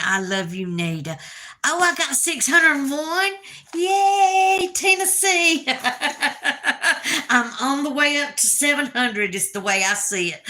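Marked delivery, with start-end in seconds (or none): 1.7-5.7 high, loud happy whoop; thrilled squeal of joy; excited cheer. Real, not acted. Cheering her viewer count. 5.7-7.2 high, giddy burst of laughter; real happy giggle; delighted laugh. Real, not acted. Laughs after cheering. 7.3-10.4 words through giggles; laughing while talking. Real, not acted. Laughing about the count.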